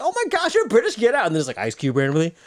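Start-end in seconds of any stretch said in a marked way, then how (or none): 0.0-1.3 high-pitched mock astonishment; squeal-pitched comic surprise; amazed host. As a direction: imitate a talk-show host's disbelief, way up high. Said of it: "he's like".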